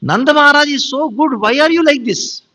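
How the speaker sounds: high-pitched, exasperated chiding; acted reproach. Acts gopis chiding Krishna.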